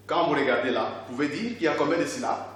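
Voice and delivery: usual speaking voice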